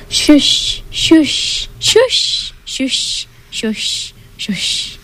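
rising then fading away to nothing; fading ski hiss; ski shush fading. A narrator's reading. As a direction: shush like skis going off into the distance. Skis on snow.

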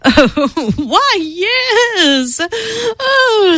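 high, delighted oh of a vain dragon; a hint of a proud dragon; boastful dragon. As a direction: preen at the compliment.

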